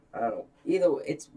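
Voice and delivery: usual speaking voice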